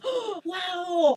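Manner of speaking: high, sudden oh of wonder; surprised gasp. Real, not acted. Seeing the crystal reveal, high surprised oh.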